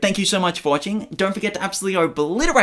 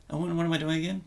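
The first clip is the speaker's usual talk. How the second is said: normal talking voice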